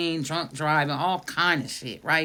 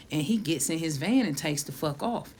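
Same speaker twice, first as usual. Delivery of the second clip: normal talking voice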